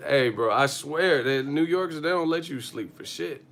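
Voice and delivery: normal talking voice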